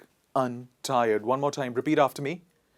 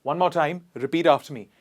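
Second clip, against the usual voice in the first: usual speaking voice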